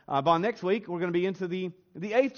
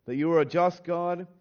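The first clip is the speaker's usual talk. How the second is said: prayer; 3 semitones lower than usual. Acted. Pastor praying for his church, hushed and reverent.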